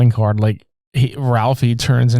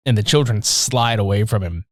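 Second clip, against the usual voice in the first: ordinary delivery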